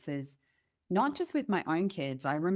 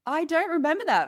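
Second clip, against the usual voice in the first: higher and louder, wide-eyed; amazed reply; 9 semitones higher, 5 dB louder than usual. Retold or imitated. Answering the little girl's strange memory, amazed. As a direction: sound delighted and baffled.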